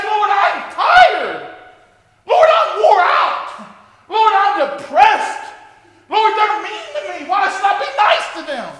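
loud, slow cry of complaint to God; pleading shout of a worn-out believer; shouted weary lament. Pretend. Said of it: "you say".

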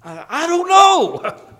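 high, slow, loud hammed-up whine; mock helpless whine. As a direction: stretch it out high like a cornered kid. Retold helpless answer.

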